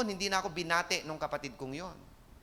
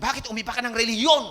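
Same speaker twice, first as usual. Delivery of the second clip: louder, higher, fast rebuke; angry father; 6 semitones higher, 8 dB louder, faster than usual. Retold or imitated. Father scolding his son for changing religion, angry. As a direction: snap it like a furious father.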